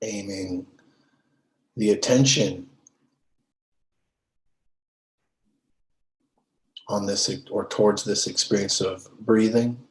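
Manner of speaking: measured, settled meditation-teacher voice, leaving space between phrases; guided meditation. Real, not acted. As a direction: guide them unhurriedly into stillness. Leads a meditation.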